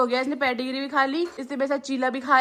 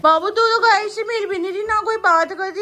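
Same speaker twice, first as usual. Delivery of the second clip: teasing laugh; 8 semitones higher, 3 dB louder than usual. Real, not acted. Teasing her daughter about the cow.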